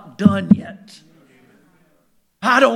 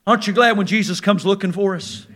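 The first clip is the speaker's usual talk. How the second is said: ordinary talk, nothing marked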